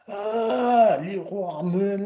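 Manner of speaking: put-on high, drawn-out French-accented "ah"; French recognition. Customs officers seeing the shop on a phone, exaggerated French realisation. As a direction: mimic French officers finally getting it, sliding down into the name. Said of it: "that's not how you say it".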